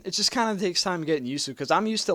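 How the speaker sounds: normal talking voice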